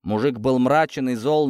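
ordinary delivery

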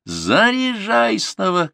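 very high, drawn-out yell; shouted command. A narrator's reading. Grandson orders a reload in the fight, shouting. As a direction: call the order out long and high. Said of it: "крикнул Артур".

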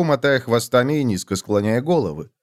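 ordinary talk, nothing marked

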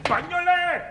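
high, sharp summons; calling out. Acted. General summoning his orderly as the girl faints, calling out. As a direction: call for help from another room.

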